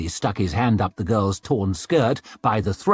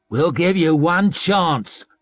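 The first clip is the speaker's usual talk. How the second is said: threatening rioter; 5 semitones higher, 4 dB louder, slower than usual. A narrator's reading.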